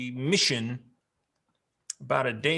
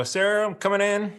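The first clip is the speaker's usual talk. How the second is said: calling out; 7 semitones higher, 6 dB louder than usual. Acted. Calling into the house.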